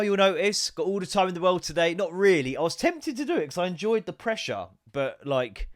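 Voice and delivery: ordinary talk, nothing marked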